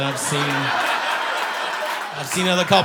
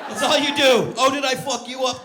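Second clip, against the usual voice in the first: high, loud, sarcastic outburst; mock taunt; 10 semitones higher, 5 dB louder than usual. Fisherman to the maimed fish, mock taunting. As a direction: jeer it, raised and forceful.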